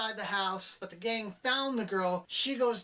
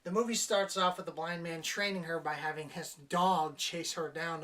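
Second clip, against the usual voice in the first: normal talking voice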